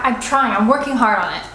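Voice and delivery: ordinary talk, nothing marked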